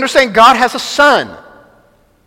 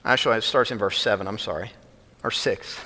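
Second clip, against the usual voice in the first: muttered correction; 11 semitones lower, 12 dB quieter, faster than usual. Real, not acted. Correcting the verse reference aside.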